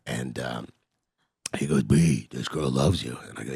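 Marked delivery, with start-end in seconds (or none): 1.8-3.2 gruff voice. Retold or imitated. Pimp friend advising about a girl, gruff. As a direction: put on a slow tough-guy rasp. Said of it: "real gruff voice, he talk like this".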